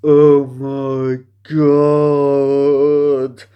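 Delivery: loud, drawn-out mock exclamation; exasperated groan. Reviewer reacting to the MJ reveal, loud drawn-out groan. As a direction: stretch it out in disbelief. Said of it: "all I wanted to do was".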